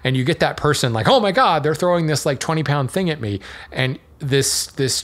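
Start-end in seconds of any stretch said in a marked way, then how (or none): 1.1-3.4 exaggerated startled outburst, easing off; mock alarm. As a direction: play someone panicking at a heavy throw. Imitating alarmed person thrown the cookie.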